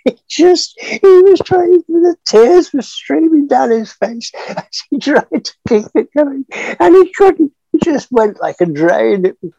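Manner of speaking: can barely get the words out for laughing. Real, not acted. Laughing while retelling co-star's giggles.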